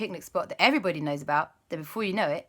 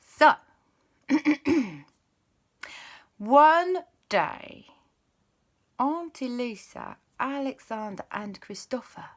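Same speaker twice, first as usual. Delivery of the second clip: ordinary delivery, nothing marked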